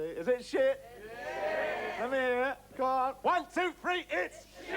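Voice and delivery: loud, high crowd count-in; rallying shout. Acted. Leading audience in a chant.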